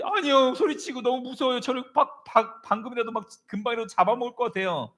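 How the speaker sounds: high-pitched, panicky pleading; acted scared whine; frightened son protesting. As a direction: protest in fear, pitched far up. Acts terrified son protesting to father.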